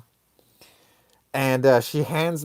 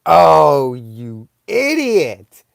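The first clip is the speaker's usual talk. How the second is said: scared self-scolding; 5 dB louder, much slower than usual. Retold or imitated. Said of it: "I'm, like, super scared".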